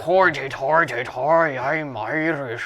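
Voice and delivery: mock Irish accent; hammy Irish superhero brogue. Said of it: "What do I sound like?".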